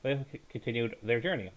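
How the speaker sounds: ordinary delivery, nothing marked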